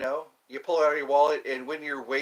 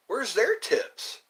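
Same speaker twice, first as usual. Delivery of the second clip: indignant question; 7 semitones higher, much slower than usual. Real, not acted. Indignant about dishwashers' tips.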